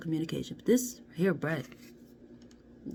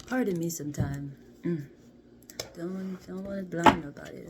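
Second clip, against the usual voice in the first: ordinary talk, nothing marked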